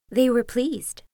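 usual speaking voice

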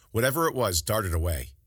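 usual speaking voice

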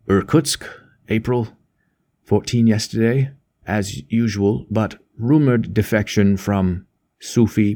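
slow, broken telegram reading, word group by word group; reading aloud haltingly. A narrator's reading. Oliver reads the news sheet to the girl, slow and halting. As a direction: read out a cable in measured fragments with gaps. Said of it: "began to read aloud".

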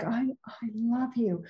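very soft, overdone love line; hushed, put-on stage declaration; weird acting voice. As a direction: say it hushed, like a bad actor reading a script. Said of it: "go into some weird".

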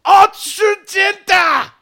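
slow, loud, high chanted shout; spell incantation. Acted. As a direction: cast it like a booming spell word. Wizard casts spell shouting.

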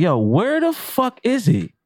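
high, drawn-out exasperated demand. Retold or imitated. Expediter asking after the missing broiler cook, exasperated.

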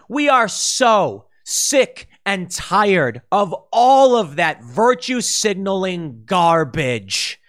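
ordinary talk

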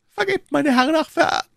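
very high, choked woman's voice; muffled high cry, cut short; stifled, slurred plea. A narrator's reading. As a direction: plead as if being gagged, words breaking off. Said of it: "lallte die Stimme, die nur unartikulierte Töne".

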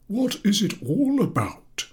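put-on slow, high and coaxing old teacher; encouraging. A narrator's reading. Professor coaxing the class, slow and encouraging. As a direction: draw the answer out gently. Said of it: "said the professor encouragingly".

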